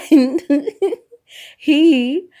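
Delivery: high, loud giggly words; words broken up by real giggles; talking through laughter. Real, not acted. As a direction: try to speak while cracking up. Still laughing as she resumes.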